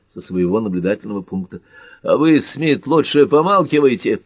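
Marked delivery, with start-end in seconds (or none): 2.0-4.2 loud, high, angry snap; heated order, pitch and volume up; barked warning. A narrator's reading. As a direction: bark a rival into silence. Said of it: "рявкнул Картер".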